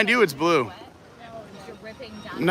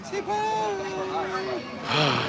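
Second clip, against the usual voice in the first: dramatic sigh; 10 dB quieter than usual. Pretend. Mock despair as friend leaves.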